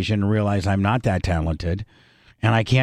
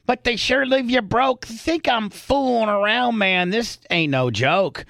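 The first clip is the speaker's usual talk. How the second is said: twang the rap up high like a hillbilly; fake country accent; 8 semitones higher than usual. Shown as a demonstration. Showing Lummi how he'd country it up, fake country accent. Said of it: "do my fake country accent".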